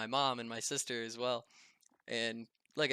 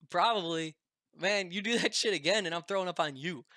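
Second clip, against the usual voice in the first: higher, louder, fast mock-threatening friend; imitating a friend's joking threat; 5 semitones higher, 5 dB louder than usual. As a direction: warn him, half joking and loud.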